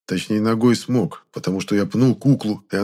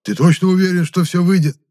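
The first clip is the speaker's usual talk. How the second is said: high, loud, anxious query; urgent raised-voice interruption; shouted question; 10 semitones higher, 7 dB louder than usual. A narrator's reading. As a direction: blurt the doubt out loudly. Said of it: "вдруг крикнул Епифан".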